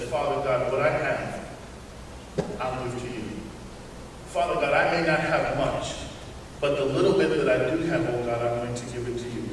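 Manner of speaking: soft, low, humble prayer of the poor widow. Acted. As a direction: pray gently as if giving all you have.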